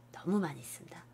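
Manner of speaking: breathy aside to the class; whisper. Real, not acted. 학생들에게 속삭이며 강조하는 선생님. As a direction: drop to a whisper for emphasis.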